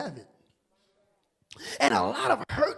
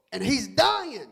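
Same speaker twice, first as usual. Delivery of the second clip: normal talking voice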